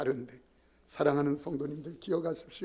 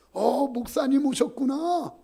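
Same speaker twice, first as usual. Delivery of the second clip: tipsy greeting; 7 semitones higher, 5 dB louder than usual. Retold or imitated. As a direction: greet the pastor like a happy drunk. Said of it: "술이 거나하게 취해가지고". (술 취한 형님이 목사를 반기는 느릿한 높은 목소리)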